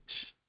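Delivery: a voiceless, hushed "sh"; "sh" alone as a hushing hiss, no vowel; sh sound. Shown as a demonstration. Teacher showing the sound of the dotted Shin, hushed sh sound. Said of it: "hace el fonema S H".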